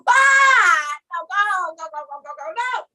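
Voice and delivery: yelp, then shoo it away; mock panic. Scared dreamer tells the angel to go, mock panic. Acted flight from the apparition.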